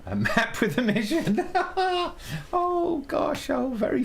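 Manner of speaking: high-pitched laugh through the pun. Real, not acted. Streamer laughing at his own pun for the viewers. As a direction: crack up at your own joke mid-line.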